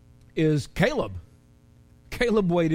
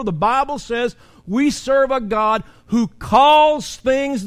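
loud, high, slow preaching with long stretched words; preaching at full force; 6 semitones higher, 6 dB louder, slower than usual. Acted. Pastor preaching hard to his church, loud and slow. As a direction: lift the room, hammer each phrase out.

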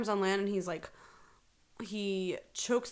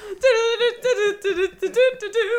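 high, loud imitation of theme music in doo syllables; sung comic theme tune; 13 semitones higher, 11 dB louder than usual. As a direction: belt out a wordless sitcom theme. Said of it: "it's the Curb Your Enthusiasm music".

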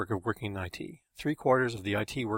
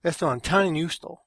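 loud, raised-pitch protest; aggrieved outburst tipping into Spanish; indignant exclamation; 6 semitones higher, 6 dB louder than usual. A narrator's reading. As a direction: protest the unfairness, voice pushed up and louder. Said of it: "Carlos exclaimed".

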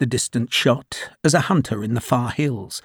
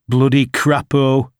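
slow, louder angry curse; 4 dB louder than usual. Acted. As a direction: spit the curse out in anger. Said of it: "he said angrily".

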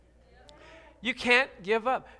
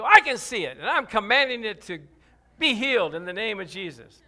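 loud, firm faith declaration; 8 dB louder than usual. Retold or imitated. Preacher tells his wife he commands the cut healed, loudly and firmly. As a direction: declare it over the wound.

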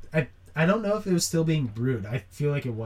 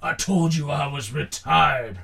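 put-on stubborn old man; 6 dB louder than usual. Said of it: "scene in any movie".

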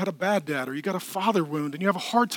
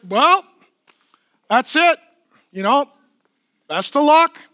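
put-on higher and louder, breezily shrugging a child off; dismissive dad; 8 semitones higher, 11 dB louder than usual. Pastor voicing a father who gives up on his runaway kid, breezy dismissal. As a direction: brush the kid off with fake cheer. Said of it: "I don't say".

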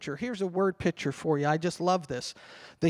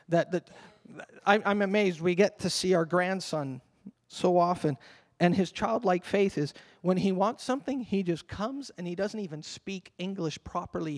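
normal talking voice

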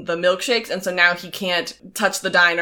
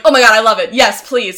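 loud, delighted mock gushing; overjoyed at the offer of fame; gushing Kevin; 3 semitones higher, 8 dB louder than usual. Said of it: "Kevin's like".

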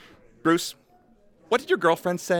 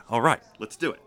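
ordinary talk

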